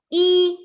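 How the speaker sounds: E high, level and stretched; first tone. Shown as a demonstration. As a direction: stretch E on one high, steady pitch. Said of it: "this is the first tone".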